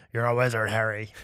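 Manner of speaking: a hint of a deep movie giant; Hagrid. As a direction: do a low, understated character impression. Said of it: "Spot on, a person-ish".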